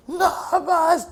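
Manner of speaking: high, loud scream; screamed prayer. Retold or imitated. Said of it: "I screamed the Shingadev prayers".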